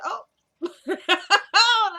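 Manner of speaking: high, loud burst of laughing; real laughter. Real, not acted. Storyteller cracking up as she retells the scene, laughing. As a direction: crack up mid-word.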